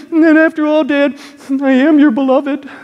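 loud, high tearful plea; imitated crying. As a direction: act out a sobbing son pleading with his father. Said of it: "He's crying".

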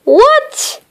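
high, startled what; a rising puzzled what; a little surprised. Acted. Mister Surprise puzzled by the parcel, a little surprised. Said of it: "sounding a little bit surprised".

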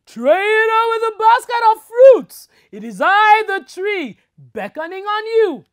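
high, loud mock spirit call; talking tree voice. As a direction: boom like a mysterious tree.

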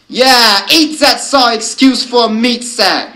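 loud, high jeering wolf call; barked jeer. A narrator's reading. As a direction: bark out a taunt to the pack. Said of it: "It was barking".

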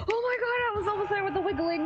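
high-pitched frantic complaint; worked up, voice shot up high; exasperated outcry. Real, not acted. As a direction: squeal over a near-miss escape. Nearly escaped killer's grip.

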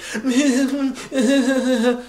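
high-pitched imitation giggle; mocking laugh. Woman and friend laughing back at him, mocking imitation. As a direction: mimic their cackling in a high voice. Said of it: "she's all laughing with her friend".

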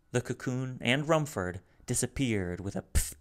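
plain reading voice

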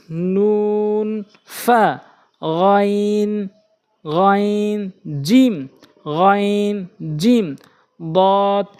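slow, drawn-out letter names for learners; careful tajweed letter drill; Quran letter recitation. Shown as a demonstration. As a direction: pronounce each Arabic letter carefully.

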